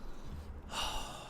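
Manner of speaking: soft breathy sigh of satisfaction; contented sigh. Real, not acted. Streamer leaning back after a winning trade, soft pleased sigh.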